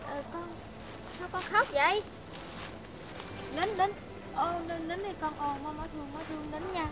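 put-on calm a crying child with tender coaxing; soothing old mother. Old mother hushing her grown son to lie down, tender.

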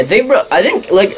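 real voice crack while gaming. Real, not acted. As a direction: voice cracking up high mid-sentence. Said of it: "oh my god, voice crack".

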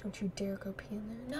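soft unvoiced whisper; hushed warning to the Sim. Real, not acted. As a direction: hiss the warning under your breath.

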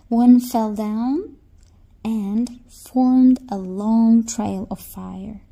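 ordinary delivery, nothing marked

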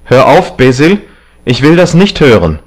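loud, high protest; agitated cry. A narrator's reading. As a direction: cry out to cut him off. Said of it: "rief Dorian aufspringend". (aufgebracht und laut gerufen)

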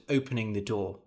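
plain reading voice

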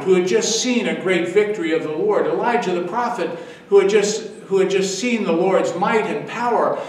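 ordinary talk, nothing marked

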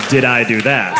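half-hearted Urkel catchphrase; a hint of Urkel's line; Steve Urkel. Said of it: "Steve Urkel can have".

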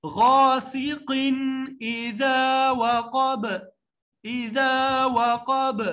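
slow, drawn-out tajweed chant; teacher reciting verse slowly; Quranic recitation. Shown as a demonstration. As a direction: recite it long and measured. Recitation demo.

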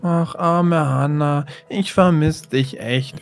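low, soft lament; wistful longing. Acted. Lovesick boy alone, missing Hannah, soft and wistful. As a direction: sigh it low and quietly to the absent beloved.

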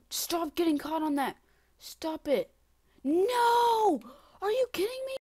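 high and loud, building to a scream; exasperated shouting. Real, not acted. Player caught on the same obstacle, exasperated shouting. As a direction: scold yourself, then explode.

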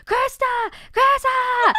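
very high-pitched mom calling a name; shrieky long-distance name call; calling from afar. Retold or imitated. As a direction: imitate mom hollering across the store. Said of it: "calling my name from afar".

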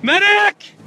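urgent shout for a medic; man down shout. Real, not acted. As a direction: yell for help across the crowd. Injured, calling for help.

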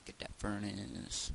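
slow, soft, drawn-out words; subdued command. Pretend. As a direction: stretch it out quietly. First, softer take of drawn-out command.

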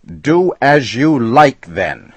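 high, loud shout at the customer. A narrator's reading. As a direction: bark the rule back at him. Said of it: "shouted Flannery".

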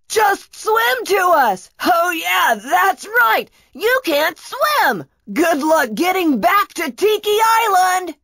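high, long-distance taunting call; mocking yell. A narrator's reading. Brachus yells to Kunatos left on the island, taunting. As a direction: yell gleefully across the water. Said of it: "Brachus yelled to Kunatos".

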